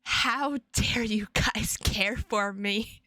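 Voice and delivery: slow whispered fake outrage; mock offended whisper. Streamer teasing chat for caring about her, mock offended whisper. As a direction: whisper it, pretending to be insulted.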